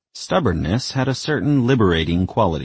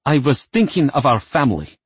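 spat; 8 semitones higher, 4 dB louder than usual. Acted. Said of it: "spat her mother".

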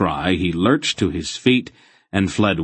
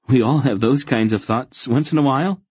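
higher, slightly awkward reassurance; nervous, embarrassed; 7 semitones higher, 4 dB louder, faster than usual. A narrator's reading. Embarrassed boy excusing impure thoughts to the fanatic, nervous and higher. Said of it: "he said with a nervous cough".

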